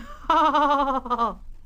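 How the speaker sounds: high mischievous giggle; cheeky laugh. Acted. Thomas laughing at Gordon, high cheeky giggle. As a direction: laugh as you dash away. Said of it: "he ran off laughing".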